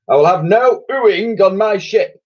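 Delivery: high, loud, blustering admiral roar; thundered. Acted. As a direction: thunder the order at the crew. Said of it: "thundered the Admiral".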